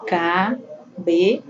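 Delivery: "Cabelos" drawn out slowly as ca, be, los; teacher slowly breaking "Cabelos" into three syllables. Shown as a demonstration. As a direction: pause after each syllable of "Cabelos". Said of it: "as pausas que tem".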